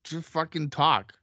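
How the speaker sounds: irritated, defensive retort; annoyed at being interrupted; exasperated comeback. Real, not acted. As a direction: push back at the heckler, fed up. Snapping back at teasing about accent.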